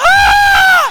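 very high, long, full-force scream; piercing reenacted shriek; astonished scream. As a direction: scream out in overwhelmed amazement. Reenacts his own astonished scream.

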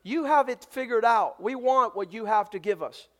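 ordinary delivery

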